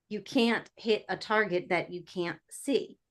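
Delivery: slow, quiet, thoughtful delivery; measured aphorism. Acted. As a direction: let each part sink in, softly and unhurried. Repeats saying for listener to ponder.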